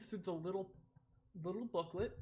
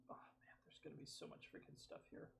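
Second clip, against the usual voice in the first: under the breath, almost whispered; hushed aside; 3 semitones lower, 17 dB quieter, faster than usual. Real, not acted. Streamer muttering to self about all the stuff here, hushed. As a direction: whisper it to yourself.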